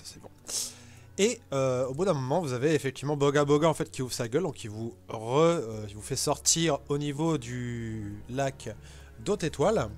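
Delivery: usual speaking voice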